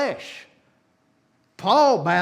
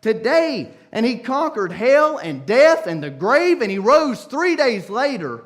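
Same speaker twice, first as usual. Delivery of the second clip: louder, driving preacher's cry; fiery preaching; 2 semitones higher, 6 dB louder than usual. Real, not acted. Preacher telling the cross story, fiery. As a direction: build it with full force.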